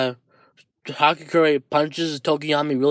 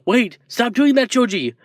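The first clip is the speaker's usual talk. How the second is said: higher-pitched plea to stop; urgent call; 8 semitones higher than usual. Acted. Tokoyami tries to stop Shoji, urgent call. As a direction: call out to halt a teammate. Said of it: "Tokiyama saying".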